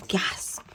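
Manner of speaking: breathy gasp of wonder; soft gasp. Acted. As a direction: gasp as if seeing a crown. Reader gasps at the crown.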